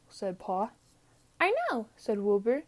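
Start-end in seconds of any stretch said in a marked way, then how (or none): 1.4-1.9 put-on high, quick, cheerful young sheep voice. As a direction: chirp it like a proud little lamb. Wilbur the sheep speaks.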